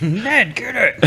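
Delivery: South Park voice. Retold or imitated. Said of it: "South Park".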